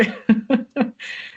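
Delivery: high-pitched amused laughter; short burst of real laughter. Real, not acted. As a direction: laugh after your own impression. Laughs after her acted quote.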